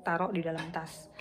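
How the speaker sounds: normal talking voice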